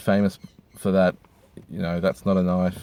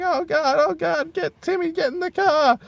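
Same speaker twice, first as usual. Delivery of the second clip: very high, frantic mock mum voice; hammed-up mum hustling her kid away; panicked mother. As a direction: squeal it like a terrified mother. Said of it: "The mother was like".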